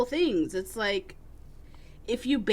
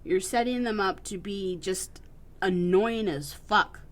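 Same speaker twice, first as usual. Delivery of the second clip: ordinary talk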